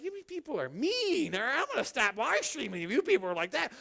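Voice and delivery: wag a finger at the crowd; mock scolding. Pastor scolding rude commenters, hammed-up mock scold.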